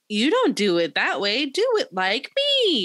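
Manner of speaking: bossy big-sister imitation, pitch climbing high at the end; mock know-it-all, stretching out the last order; Emmy. Podcast host quotes the older sibling bossing others, high-pitched mock bossiness. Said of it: "Emmy was like".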